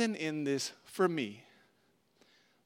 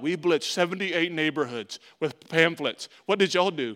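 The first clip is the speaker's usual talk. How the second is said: louder, boastful mock brag; bragging pastor; 6 dB louder than usual. Pastor mocking rivals' brag, loud and boastful. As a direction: puff up like a proud rival pastor. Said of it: "When I'm hearing all these stories".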